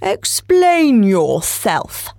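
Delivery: deliberate, stern and drawn out. Acted. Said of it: "said the caterpillar sternly".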